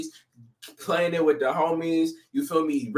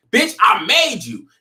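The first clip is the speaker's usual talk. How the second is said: slow, louder, cold emphasis on made; blunt hard reading; 5 semitones higher, 5 dB louder, slower than usual. Shown as a demonstration. Fan showing the harsh meaning, slow and blunt. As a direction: say what he really means, harsh. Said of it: "Just change the tonality, the inflection".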